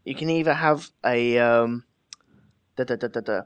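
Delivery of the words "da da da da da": normal talking voice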